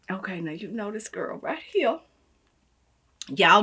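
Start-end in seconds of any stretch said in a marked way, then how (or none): 0.1-2.0 conspiratorial whisper; hushed teaser. Real, not acted. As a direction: whisper it like a secret reveal.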